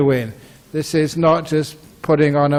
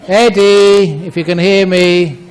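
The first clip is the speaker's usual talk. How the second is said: loud, raised call to get Eddie's attention; 3 semitones higher, 4 dB louder than usual. Real, not acted. Monk calls to noisy Eddie before his talk, calling out. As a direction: call out over the noise, pitched up.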